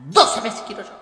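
ordinary delivery

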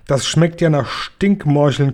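ordinary delivery

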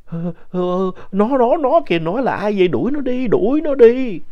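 put-on very high, loud, panicked old woman's cry; imitating grandma in a fright; terrified grandma. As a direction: shriek at the unseen thing to get it away. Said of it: "nói".

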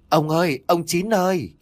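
high-pitched call for a missing husband. A narrator's reading. Wife searches the dark yard for her husband, calling out.

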